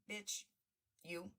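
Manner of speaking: usual speaking voice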